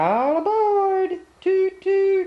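high sing-song train call; train whistle voice. Pretend. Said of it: "It says".

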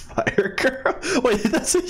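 high, laughing question; amused disbelief. Real, not acted. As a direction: crack up while questioning the words. Cracks up at own misreading.

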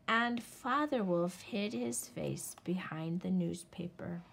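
plain reading voice

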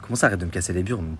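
ordinary talk, nothing marked

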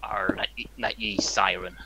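hushed pirate growl; breathy British pirate mutter; whispered pirate accent. Acted. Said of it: "I'm a British pirate".

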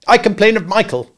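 high, loud tattle; instant. A narrator's reading. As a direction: blurt it at once. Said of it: "said Curly instantly".

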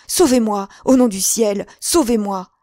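loud, high plea for rescue; frantic cries of a hunted man; desperate cry. A narrator's reading. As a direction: beg for your life, urgently and loudly. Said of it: "s'écria-t-il".

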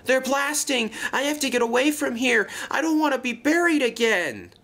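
high, rushing alarm; panicked gasp. A narrator's reading. Luke terrified of being buried by blasting, panicking. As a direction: cry out in mounting fright, desperate to flee. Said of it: "gasped Luke".

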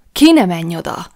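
wife crying out as he heads for the door; sudden alarmed cry. A narrator's reading. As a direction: stop him at the door in sudden fright. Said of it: "kiáltottam hirtelen rémülettel".

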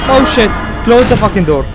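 startled yelp then frantic order; sudden scared outburst; panicked shout. Real, not acted. Sudden fright in horror game.

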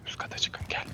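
urgent whispered orders; whispering. Real, not acted. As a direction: whisper them upstairs. Sneaking, directing squad upstairs.

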